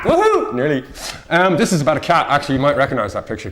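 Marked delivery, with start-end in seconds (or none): 0.0-0.5 high excited woohoo; delighted high whoop. Real, not acted. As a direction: cheer it high. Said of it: "cheering own picture catch".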